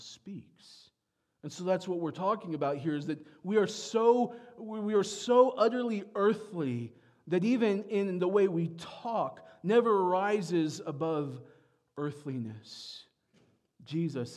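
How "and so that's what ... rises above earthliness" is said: raised, louder preaching push. Acted. Pastor pressing the church on how Americans think, preaching louder. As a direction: drive the point home to the congregation. Preacher drives home earthliness.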